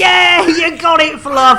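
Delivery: loud, high cheer for Fluff; cheering shout. Real, not acted. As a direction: shout out the praise. Cheering a crew member.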